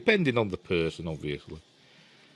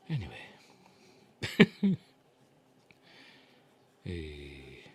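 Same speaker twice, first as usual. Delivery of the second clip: usual speaking voice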